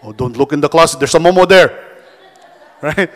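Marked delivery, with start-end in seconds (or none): 0.0-1.8 louder mock spooky warning; scaring relatives. Uncle warning kid about the closet, hammed-up scare. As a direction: tease the kid with a monster in the closet. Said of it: "why uncles and aunts would, would scare kids".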